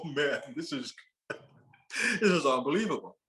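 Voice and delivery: words broken up by laughter; laughing disbelief. Retold or imitated. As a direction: retell it chuckling, amazed. Laughs retelling own disbelieving reply.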